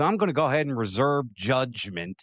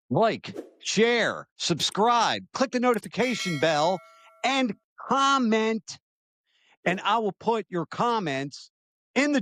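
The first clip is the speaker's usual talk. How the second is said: higher, bright call to like and subscribe, stretching the last word; upbeat sign-off call; 9 semitones higher than usual. Acted. Host urging viewers to like and subscribe, higher and eager. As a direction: pitch it up like a channel host rallying viewers.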